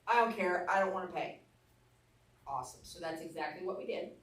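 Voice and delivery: normal talking voice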